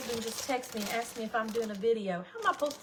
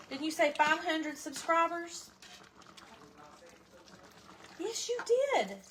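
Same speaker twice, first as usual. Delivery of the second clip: playful teasing; 5 semitones higher than usual. Real, not acted. Teasing son about promise.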